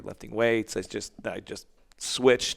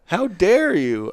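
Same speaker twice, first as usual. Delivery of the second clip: much higher, louder, put-on offended voice; mock indignation; 12 semitones higher, 13 dB louder, slower than usual. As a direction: play a scandalized snob, slowing at the end. Joins mock outrage bit.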